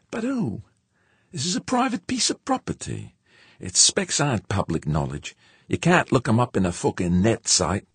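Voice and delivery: very high, insistent character voice; George, exasperated puzzling. A narrator's reading. Spaceman puzzles over leaked specs with crewmate, high insistent voice.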